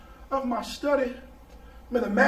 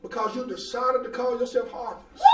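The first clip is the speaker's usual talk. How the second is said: preaching at full force; 3 semitones higher than usual. Acted. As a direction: drive it home to the church. Preaching climax with congregation responses.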